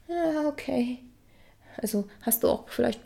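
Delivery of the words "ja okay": meek yes. Retold or imitated. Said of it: "du lächelst und sagst so".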